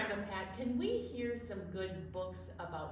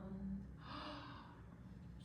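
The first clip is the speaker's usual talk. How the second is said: soft gasp; 5 semitones higher, 12 dB quieter than usual. Acted. Wonder after finger play.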